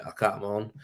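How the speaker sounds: usual speaking voice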